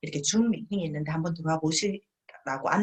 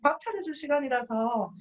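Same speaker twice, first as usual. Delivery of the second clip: much higher, whiny refusal; fussy excuse; 7 semitones higher than usual. Retold or imitated. Partner turning down the invite for the friend, whiny. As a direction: sigh it out as an excuse. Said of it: "막 이런, 이런 분도 있고".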